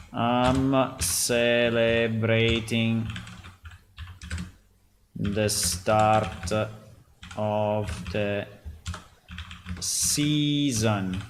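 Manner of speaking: slow, word by word while typing; slow dictation. Real, not acted. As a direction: spell it out slowly as you type.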